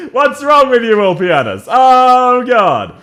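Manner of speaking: loud, high exasperated outburst; exasperated scolding. Real, not acted. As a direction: wail at your useless teammate. Scolding a game character that misplays.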